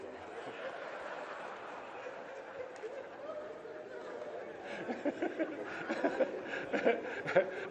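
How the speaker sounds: high, breathless laugh at his own story; real laughter that takes over; long laugh. Real, not acted.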